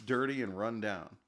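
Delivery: ordinary talk, nothing marked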